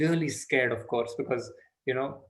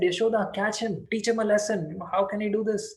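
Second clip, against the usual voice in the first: higher, eager urging of onlookers; urging neighbors; 6 semitones higher than usual. Acted. Sympathetic neighbors urging Yashoda to catch the boy. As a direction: egg her on to catch him.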